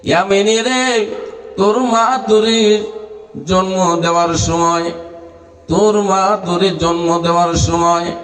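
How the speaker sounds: intoned preaching, rising to high, ringing phrases; sing-song preacher with stretched line endings; melodic sermon storytelling. Acted. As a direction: chant a reproach to the son.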